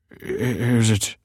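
slow, hesitant stammer; faltering, apprehensive father. A narrator's reading. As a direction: falter over the first word. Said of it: "stammered the father".

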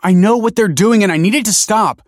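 high, loud desperate plea; pleading. A narrator's reading. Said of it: "pleaded Michael".

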